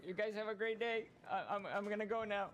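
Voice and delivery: high, sing-song goodbye; mock cheerful farewell. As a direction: chirp a fake polite goodbye. Leaving game, mock farewell.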